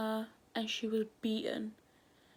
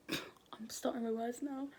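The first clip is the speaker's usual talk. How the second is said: quick whispered correction; hushed aside; 6 dB quieter than usual. Real, not acted. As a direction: whisper it under your breath. Whispering aside while recording.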